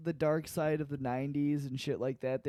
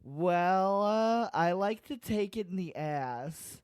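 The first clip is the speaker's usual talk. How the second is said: louder, slow, deliberate mock answer; put-on son's voice; 5 semitones higher, 5 dB louder, slower than usual. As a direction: answer the host slowly and proudly. Acts the son answering Doctor Phil.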